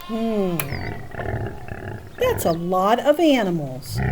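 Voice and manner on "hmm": ordinary delivery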